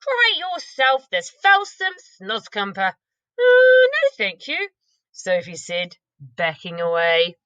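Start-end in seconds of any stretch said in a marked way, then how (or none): 0.0-3.0 much higher, louder disgusted giant; high, louder hammed-up disgust. BFG voicing disgust. 3.4-4.7 put-on very high, loud girl's refusal; little girl backing away; high yelping no thanks; Sophie voice.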